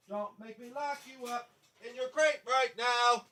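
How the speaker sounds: high-pitched dog talk, off mic; high singsong coaxing for a dog; pet-owner voice. Real, not acted. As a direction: coo to your puppy from across the room.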